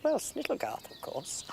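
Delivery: high, light mock voice for the old housekeeper; imitating Dinah. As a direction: pitch it up to play the old girl. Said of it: "She said".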